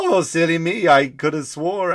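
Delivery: wife brushing off being corrected by her husband; lightly voiced, hammed-up embarrassed wife. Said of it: "she walks off embarrassed".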